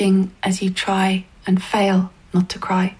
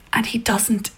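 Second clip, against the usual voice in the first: usual speaking voice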